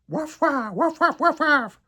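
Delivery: high, loud little dog yaps; girl yapping waf. A narrator's reading. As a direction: yap like a tiny chihuahua. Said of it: "Camomille s'approche et jappe".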